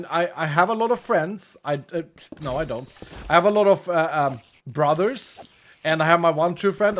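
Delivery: ordinary talk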